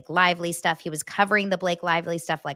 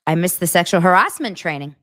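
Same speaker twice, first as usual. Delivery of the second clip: loud imitation, pitch jumping up on "harassment"; voice goes up; 7 dB louder than usual. Mimicking the man on the recording, voice going up on "harassment". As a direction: punch "harassment" with a sharp rise, then fall. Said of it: "His voice goes up".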